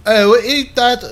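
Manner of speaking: put-on high, loud botched British accent; bad British accent. Trying out an accent, badly faked British. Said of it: "I can't do a British accent".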